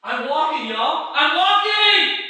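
high, loud cry building to a drawn-out finish; exultant shout. Acted. Walker announcing he is on the water, exultant shout. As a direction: holler it out to everyone, amazed. Amazed walker tells everyone.

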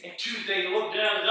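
ordinary delivery

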